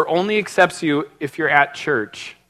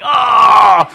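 higher, loud excited "Oh!"; excited shout; 5 semitones higher, 9 dB louder than usual. Retold or imitated. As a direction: burst out like a thrilled kid. Kid excited about a Nintendo.

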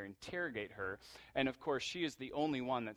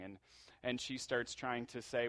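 ordinary talk, nothing marked

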